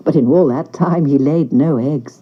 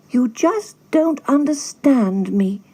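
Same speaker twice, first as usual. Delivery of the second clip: slow, higher, hurt duckling voice; 6 semitones higher, 3 dB quieter, slower than usual. A narrator's reading. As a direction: plead sadly like a lonely duckling. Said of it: "said the duckling".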